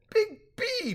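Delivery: very high, gleeful voice; delighted repeat. A narrator's reading. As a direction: try out the nickname with glee. Gerald delightedly repeats new nickname.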